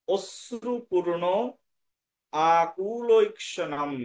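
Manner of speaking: অশ্রুপূর্ণ আকুলৈক্ষণম slowly sounded out with long vowels; slow teacher's model of অশ্রুপূর্ণ আকুলৈক্ষণম; correct Sanskrit pronunciation. Shown as a demonstration. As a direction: draw out each part of অশ্রুপূর্ণ আকুলৈক্ষণম. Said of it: "শুদ্ধ উচ্চারণ চলে আসবে".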